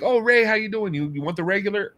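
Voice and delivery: high, delighted recognition of a regular; imitating welcoming staff. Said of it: "And they're like".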